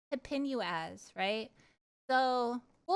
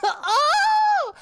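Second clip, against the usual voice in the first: shrieking laugh and shout; 12 dB louder than usual. Real, not acted. Streamer finally pronouncing the word, loud shriek of laughter.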